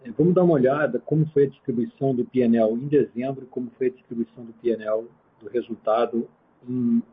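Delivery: ordinary talk, nothing marked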